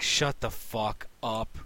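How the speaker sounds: slow, drawn-out fed-up retort; exasperated mock telling-off, stretched out. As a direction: tell off the heckler word by word. Acted reply to noisy moviegoers.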